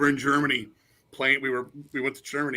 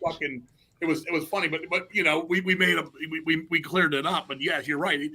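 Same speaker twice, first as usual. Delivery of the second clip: ordinary talk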